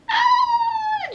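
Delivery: long, very high whimper; loud, drawn-out high whine of pain. Retold or imitated. Patient too drained to cry, high whimpering. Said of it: "just whimpering".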